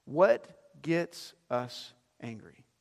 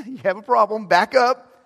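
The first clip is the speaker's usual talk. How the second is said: louder, laughing mock rebuke; mock scolding; 4 semitones higher, 8 dB louder than usual. God scolding Cain face-to-face, loud mock rebuke. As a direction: playfully bark a warning with a laugh.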